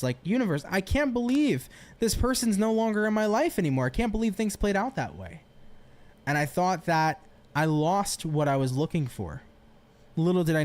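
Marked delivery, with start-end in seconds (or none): 0.2-5.4 retold upset complaint, pitched higher. Speaker recalls pleading with the universe over a lost person, upset. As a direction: protest in mild, higher-pitched disbelief. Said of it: "I remember being so upset at the universe".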